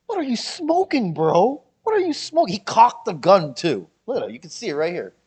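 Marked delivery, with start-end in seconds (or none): 0.1-2.5 high-pitched, loud disbelief; incredulous retort. Retold or imitated. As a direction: throw it out loudly, incredulous. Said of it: "I'm like".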